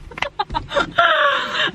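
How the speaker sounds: high squealing burst of laughter; shrieking laugh. Real, not acted. Friend's nails look funny.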